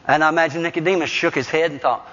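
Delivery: ordinary talk, nothing marked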